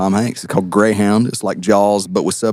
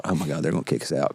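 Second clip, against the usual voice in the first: hushed worried thought; 5 semitones lower, 10 dB quieter, faster than usual. Retold or imitated. Retells worried thought after prank.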